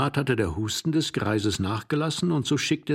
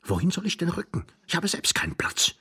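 higher, irritated whisper; young stranger; 7 semitones higher than usual. Acted. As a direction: hiss it back, annoyed. Stranger hissing back, hiding. (gereizter junger Mann)

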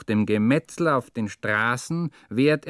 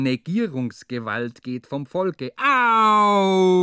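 higher, louder, slow mock solemn decree; hammed-up state slogan, slow and loud; mock proclamation; 7 semitones higher, 6 dB louder, slower than usual. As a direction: pronounce it like a pompous official slogan. Mocking the constitutional phrase.

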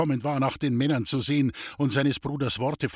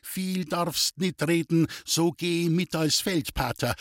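ordinary delivery, nothing marked

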